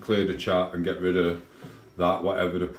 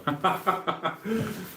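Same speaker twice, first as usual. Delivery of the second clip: a sudden high-pitched burst of laughter; soft high giggle; 10 semitones higher, 5 dB quieter than usual. Real, not acted. Laughing at the comment read aloud.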